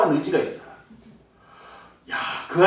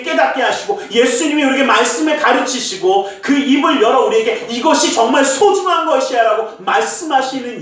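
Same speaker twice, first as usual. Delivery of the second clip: loud, higher, impassioned sermon voice; fervent preaching; 5 semitones higher, 5 dB louder than usual. Acted. Pastor urges the congregation at the sermon's climax, loud and fervent. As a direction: preach it out at full force.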